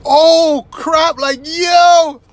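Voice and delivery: high, loud startled exclamation; mock gasp of disbelief; shocked boyfriend. Boyfriend spots driverless car, high startled exclaim. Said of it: "It's like".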